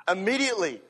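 normal talking voice

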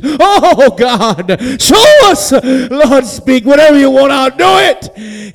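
hammed-up, high, loud prayer cry; trying to sound religious. Begging God on the floor, mock-religious shout. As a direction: wail it to God with showy piety. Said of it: "I tried to sound religious".

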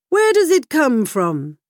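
king questioning the groom, stern; king's demand. A narrator's reading. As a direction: press for an answer like a king. King demands crown's origin.

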